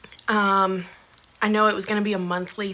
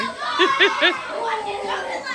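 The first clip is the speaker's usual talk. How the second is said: laughing; 12 semitones higher than usual. Real, not acted. Laugh heard.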